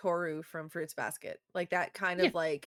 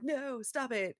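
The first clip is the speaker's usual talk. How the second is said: higher, whiny objection; vampire's mock protest; 7 semitones higher, slower than usual. Vampire to the dying girl, protesting. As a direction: protest as she slips away. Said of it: "he's like".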